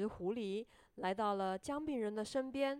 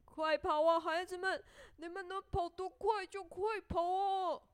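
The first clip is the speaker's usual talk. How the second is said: loud high taunt, easing into mock laughter; laughing shout; 6 semitones higher, 6 dB louder than usual. A narrator's reading. Gingerbread man racing off from the cow, laughing shout. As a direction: shout gleefully at everyone chasing you. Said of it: "大声地笑着， 大声地喊着".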